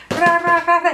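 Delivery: high, rapid-fire rolled "rra"; imitated fly swatting. Comedian imitating woman with a flyswatter, frantic swatting noises. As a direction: swat away with repeated high trills.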